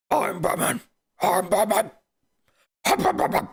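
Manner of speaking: rasping superhero growl; Batman. Acted. Streamer plays the caped hero announcing himself, growled impression. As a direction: growl it out, breaking into garbled rasp.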